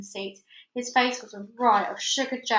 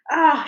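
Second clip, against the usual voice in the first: loud high scream as she falls; falling scream; falling cry; 5 semitones higher, 10 dB louder than usual. Acted. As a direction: scream tumbling down the well. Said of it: "she cried as she tumbled".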